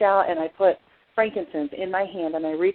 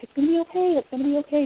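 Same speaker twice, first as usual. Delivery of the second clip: soothing; 9 semitones higher, faster than usual. Retold or imitated. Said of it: "I'm like".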